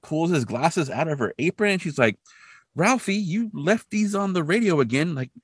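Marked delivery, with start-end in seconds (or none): 2.8-5.3 high imitated mom voice; chiding mother. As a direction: gently scold a child in raised pitch. Mother voiced mildly chiding son.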